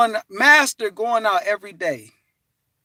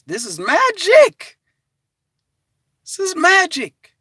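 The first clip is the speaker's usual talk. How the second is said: emphatic; 9 semitones higher, 3 dB louder, slower than usual. Real, not acted. Preaching the magic.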